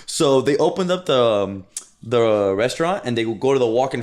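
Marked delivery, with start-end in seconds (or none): none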